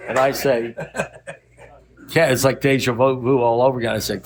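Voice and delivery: ordinary delivery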